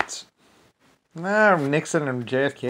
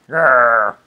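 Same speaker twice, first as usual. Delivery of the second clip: growl; 11 dB louder than usual. Real, not acted. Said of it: "I can get nasty".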